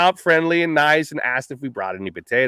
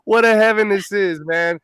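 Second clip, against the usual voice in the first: delighted; 5 semitones higher, 3 dB louder than usual. Retold or imitated. Retold delight, smoking allowed.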